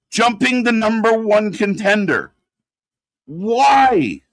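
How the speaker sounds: exasperated outburst. Real, not acted. Wrestling fan fed up with the booking, exasperated outburst. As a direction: cry out in baffled exasperation.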